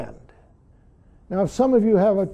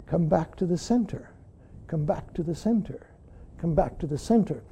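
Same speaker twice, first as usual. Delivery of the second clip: soft guiding repetition; 4 semitones lower, 10 dB quieter than usual. Shown as a demonstration. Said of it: "repeated guiding instruction".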